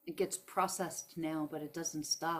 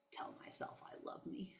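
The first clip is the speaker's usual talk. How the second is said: a scornful hushed scoff at the idea; mocking whisper; 3 semitones higher, 12 dB quieter than usual. Talking to viewers who doubt self-love, a mocking whisper. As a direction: breathe it out as a sarcastic aside.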